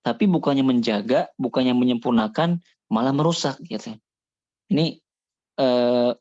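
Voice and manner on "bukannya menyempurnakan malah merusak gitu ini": normal talking voice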